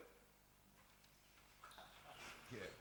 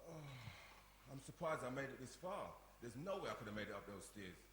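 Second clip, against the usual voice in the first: groggy, just woken; 12 semitones lower, 3 dB quieter than usual. Acted. Brother just woken on sofa.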